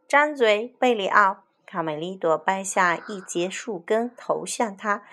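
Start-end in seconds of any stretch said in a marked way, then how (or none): 0.1-1.4 louder, higher order; firm command. Acted. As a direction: tell him sharply to open up. Command to Berio.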